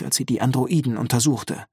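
plain reading voice

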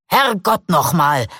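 slow, loud outburst of a surgeon; Ausruf. A narrator's reading. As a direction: burst out slowly and loudly in frustration. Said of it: "schrie er plötzlich". (langsamer, lauter Ausbruch)

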